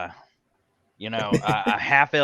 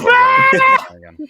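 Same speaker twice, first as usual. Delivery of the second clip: loud, high, exasperated yell of refusal; exasperated shout; 14 dB louder than usual. Acted. Guard refusing the bathroom, shouting in disgust. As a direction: scream it out in disgust.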